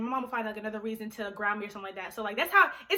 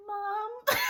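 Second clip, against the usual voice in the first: timid, higher-pitched call to mom; scared; 8 semitones higher than usual. Retold or imitated. Daughter asking mom about the party, scared. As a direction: imitate a nervous, tentative plea for mom's attention. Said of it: "I was so scared".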